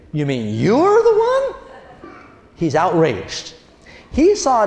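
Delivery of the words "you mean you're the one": high, slow, incredulous mock; high drawn-out scoff with a laugh; scoffing disbelief. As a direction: sneer it out in disbelief. Goliath scoffs at David.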